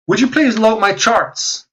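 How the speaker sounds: high exasperated plea to the computer; pleading with a machine. Real, not acted. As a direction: beg the frozen screen, pitch way up. Begging slow chart software to load.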